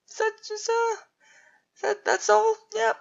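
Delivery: high, halting voice close to crying; on the verge of tears. Acted. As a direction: stammer it out, crushed and tearful. Crushed on learning it was fantasy.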